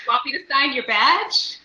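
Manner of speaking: high, loud, comic question; mock nervous offer. Imitating the flustered director offering autographs, mock eager. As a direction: pipe up with a jokey rising offer.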